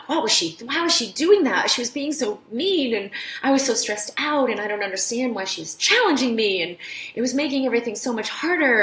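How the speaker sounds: imitating a flustered, upset student; upset rant. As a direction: vent like a stressed student who feels attacked. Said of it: "was really upset".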